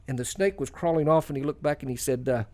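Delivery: ordinary talk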